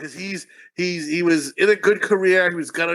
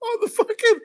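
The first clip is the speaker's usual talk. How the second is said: high, squealing through laughter; helpless laughter lifting the voice way up; laughing hard. Real, not acted. Friend jokes about being barred from Wahlburgers, laughing hard.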